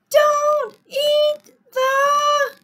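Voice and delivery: slow, high, loud shout; yelled warning. A narrator's reading. Children warning Sammy what not to eat, yelled. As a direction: yell word by word, left hanging. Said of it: "yelled the children".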